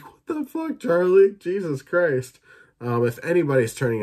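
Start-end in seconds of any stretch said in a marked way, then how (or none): 0.0-2.4 shrieking high disbelief, loud; shocked outburst. Retold or imitated. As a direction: yelp it in horrified disbelief. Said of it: "I was like".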